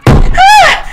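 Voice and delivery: very high, loud shriek; piercing cry of shock; terrified scream. A narrator's reading. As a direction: let out a sudden high scream. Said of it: "soltó un grito".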